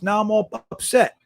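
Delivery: ordinary talk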